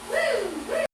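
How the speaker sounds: high whooping cheer; excited whoop. Real, not acted. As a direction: whoop with glee. Excited at the spray.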